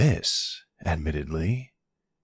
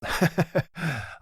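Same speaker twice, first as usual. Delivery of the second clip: high, light chuckle; amused chuckle; 12 semitones higher than usual. Acted. Kidnapper agreeing the guy is a total loser, amused chuckle. Laughs along at insult to governor.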